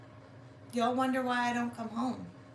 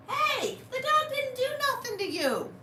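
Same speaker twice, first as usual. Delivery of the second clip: higher, lightly voiced mock scold; 13 semitones higher than usual. Telling the evil cat off for the dog, high mock scolding.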